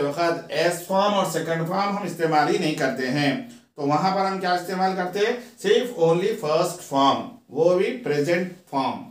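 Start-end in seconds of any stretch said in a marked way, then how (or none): none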